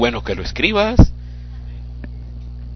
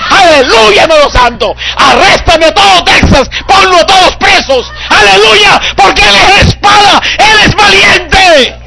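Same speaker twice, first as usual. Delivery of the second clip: much higher and louder, hallelujah climax; shouted preaching; 9 dB louder than usual. Acted. (predicación gritada, mucho más aguda y fuerte)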